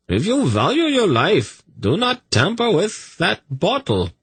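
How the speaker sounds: high-pitched urgent warning; pleading. A narrator's reading. Zadi warning priests off his bottle, pleading. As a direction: implore them in a high voice. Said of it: "Zadi pleaded".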